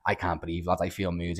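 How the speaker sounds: subdued, understated imitation of feeling unwell; imitating mild panic. Friend admits he can't breathe in the bar queue, mildly anxious. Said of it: "having, like, a bit of an anxiety attack".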